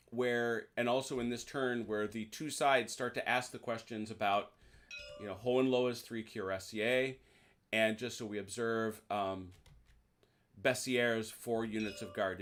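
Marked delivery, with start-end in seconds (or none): none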